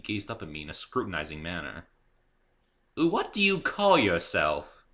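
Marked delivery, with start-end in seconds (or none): 2.9-4.8 higher, louder, slow mare voice; a middle-aged mayor sizing up a stranger; mayor's question. A narrator's reading. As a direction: ask it with measured scrutiny.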